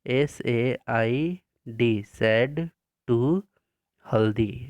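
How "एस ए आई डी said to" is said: slow, spaced-out letters for copying; slow dictation spelling. Shown as a demonstration. Teacher dictating spelling slowly to pupils. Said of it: "फिर से वही लिखेंगे".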